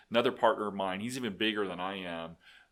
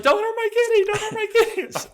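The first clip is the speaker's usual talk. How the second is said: high shrill-free falsetto mock of a distraught old lady, much higher and louder; mimicking the lady; 12 dB louder than usual. Mocking the lady pleading for her kitten, high falsetto. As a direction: squeal it in a high mock lady voice through laughter. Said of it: "She's like".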